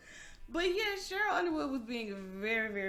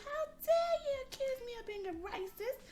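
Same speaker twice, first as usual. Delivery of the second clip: furious outburst; 13 semitones higher, 12 dB louder than usual. Retold or imitated. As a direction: play an outraged woman near tears. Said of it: "she just lost her shit".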